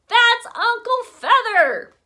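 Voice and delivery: loud, high, slow yell. A narrator's reading. Boy chasing Libby, high loud yell. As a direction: shout it across the room. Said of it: "I yelled".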